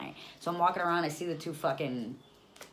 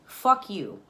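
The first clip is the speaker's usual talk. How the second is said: loud, higher-pitched snap; angry retort; 6 semitones higher, 9 dB louder than usual. Retold or imitated. Stranded mother to the airline guy, angry retort. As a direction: fire it back, fed up.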